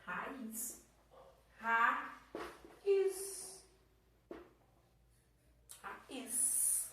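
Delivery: "Raiz" split into ra, iz with long gaps; teacher's syllable-split "Raiz". Shown as a demonstration. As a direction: pull "Raiz" apart slowly, stretching each syllable. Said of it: "vamos separar ela".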